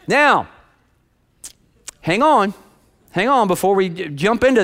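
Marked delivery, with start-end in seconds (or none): none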